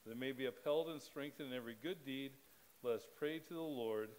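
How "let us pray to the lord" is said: ordinary delivery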